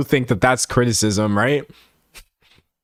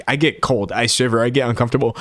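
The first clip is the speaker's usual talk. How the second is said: usual speaking voice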